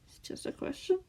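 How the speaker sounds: soft whispered reply; gentle point. A narrator's reading. Scar answering his captor mildly, whispered. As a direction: point it out softly. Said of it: "Scar pointed out".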